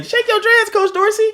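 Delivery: high mocking kid voice; imitating the kids. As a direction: mimic the students' chant at their coach. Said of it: "that's what them kids be saying".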